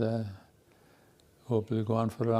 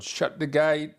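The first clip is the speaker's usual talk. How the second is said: put-on Cockney accent; 6 semitones higher, 3 dB louder, slower than usual. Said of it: "his Cockney accent".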